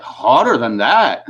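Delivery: drawn-out, slow incredulous question; mock amazement. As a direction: stretch it out as the amazed officer. Amazed officer retold, mesmerized.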